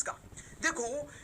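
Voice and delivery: usual speaking voice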